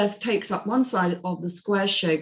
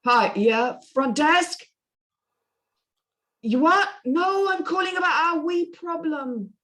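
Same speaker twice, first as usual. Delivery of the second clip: put-on a higher, slurring drunk Brit on the phone; drunk Jason Statham; 9 semitones higher than usual. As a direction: barrel through like a tipsy hotel guest. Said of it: "a voice like a drunk Jason Statham".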